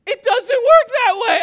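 high-pitched, loud comic outburst; exasperated yell. Retold or imitated. Husband objects to moving the table inside, exasperated yell. Retold exasperation at wife's suggestion.

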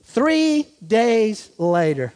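slow, word-by-word "Three days later"; measured reading with long pauses; drawn out. Shown as a demonstration. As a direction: let each word hang for effect. Slow first reading, then repeated.

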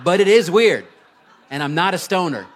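loud laughing protest. Real, not acted. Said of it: "I kinda sound like a stoner right now".

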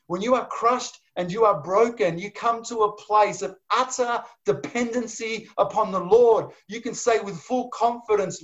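high, pleading preacher's cry; impassioned preaching. Acted. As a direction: preach it at full force, pleading. Preaching the crushed heart at full force.

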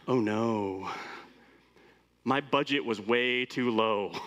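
deflated, slow worried mutter; dismayed inner voice. Retold or imitated. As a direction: sink into embarrassment. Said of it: "you're like".

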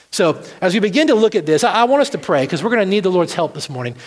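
ordinary talk

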